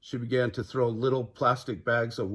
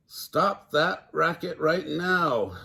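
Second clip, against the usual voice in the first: slow, higher angry shout; 6 semitones higher, much slower than usual. A narrator's reading. Alligator over the blaring television, slow shout. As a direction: draw the order out angrily. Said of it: "shouted Alligator".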